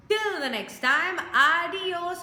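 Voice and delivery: upbeat outro call; cheery sign-off. Real, not acted. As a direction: send them off with a cheerful flourish.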